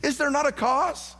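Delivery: lightly voiced David pleading, rising high; rallying David. David to the trained soldiers, mild rallying plea. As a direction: challenge the soldiers, climbing on the last question. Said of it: "and said".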